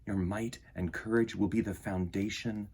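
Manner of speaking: soft, measured storybook reading; reading to children. Acted. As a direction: read it gently to a young audience, letting lines land. Reads picture book aloud.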